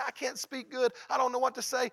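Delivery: high, loud whining excuse; mock panicky believer. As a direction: plead it high and loud like a scared beginner. Voicing a timid believer.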